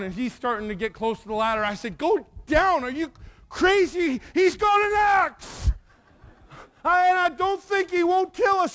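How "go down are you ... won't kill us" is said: high, loud acted alarm; retold frantic shout at a friend; panicked yell. Boy warns friend about the axe, panicked yelling. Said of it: "we're getting real nervous".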